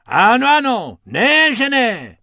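high, loud insistent roar; hammed-up bellowing insistence. A narrator's reading. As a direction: hippo bellowing yes. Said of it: "římal Hubert".

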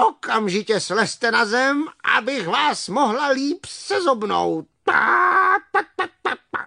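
high, clucking hen giving orders; angry hen. Acted. Hen ordering bumblebees down to peck them, angry clucking. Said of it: "řekla slepice".

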